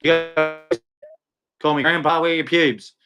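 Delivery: usual speaking voice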